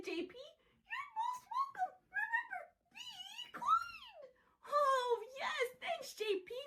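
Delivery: very high, very soft squeaky voice; tiny high puppet voice. Acted. Voicing J P and self.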